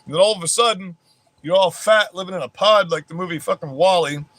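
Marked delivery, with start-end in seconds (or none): none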